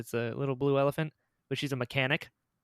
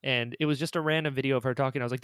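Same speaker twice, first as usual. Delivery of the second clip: ordinary delivery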